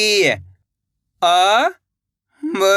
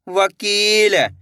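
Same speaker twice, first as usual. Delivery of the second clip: slow blending; much slower than usual. Shown as a demonstration. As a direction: draw the word out slowly, syllable by syllable. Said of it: "इसको इस तरीके से पढ़ लेना है". (तकलीफ को बहुत धीरे खींचकर बोलें)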